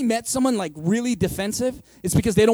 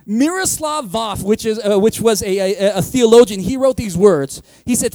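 normal talking voice

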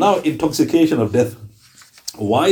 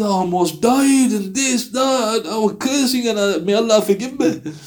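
higher, slow, a touch of exhausted recollection; weary mother recalling; 7 semitones higher than usual. Acted. Mother telling how childbirth nearly killed her, higher and slow. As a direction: sigh it out like you barely survived.

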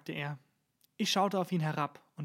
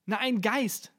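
horrified outcry, raised and louder; 5 semitones higher, 5 dB louder, slower than usual. A narrator's reading. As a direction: blurt it out in horror. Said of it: "sagte ich entsetzt".

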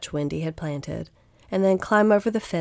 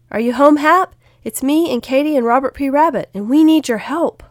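high, loud call through a door; raised, carrying voice asking for help; 9 semitones higher, 9 dB louder than usual. A narrator's reading. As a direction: call out to see who's home. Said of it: "knocked on the door and called out".

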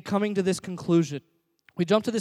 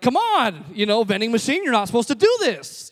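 higher, louder exasperated complaint; frustrated; 5 semitones higher, 7 dB louder than usual. Retold or imitated. Customer scolds a vending machine, frustrated. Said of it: "You're getting frustrated".

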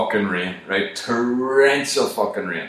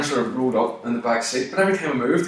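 ordinary delivery

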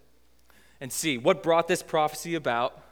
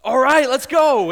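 put-on much higher and louder, a gleeful kid's cheer; eager kids; 9 semitones higher, 13 dB louder than usual. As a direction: cheer it like a toddler charging off. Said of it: "And they're like".

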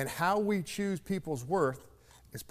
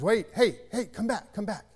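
put-on higher, louder call after the boy; calling him back; 8 semitones higher, 3 dB louder than usual. Storekeeper calling the boy back to the counter, raised higher voice. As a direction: call out to stop him leaving.

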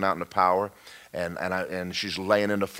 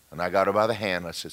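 ordinary talk, nothing marked

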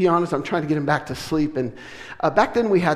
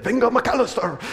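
Spanish announcer; 7 semitones higher than usual. Retold or imitated. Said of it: "then it's translated".

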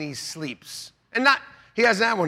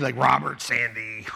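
normal talking voice

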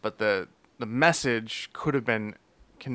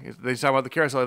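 ordinary talk, nothing marked

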